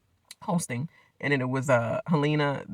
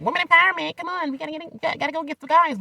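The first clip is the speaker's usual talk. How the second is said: much higher mock rallying voice; mocking the women; 13 semitones higher than usual. Mocking the houseguests' empowerment talk, higher. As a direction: parody a cheesy pep talk. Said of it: "I know they're like".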